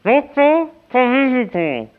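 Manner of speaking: mumbling round a mouthful of gauze; mouth full. Pretend. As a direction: slur the name through dental packing. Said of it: "Ich hab eine Tamponade im Mund".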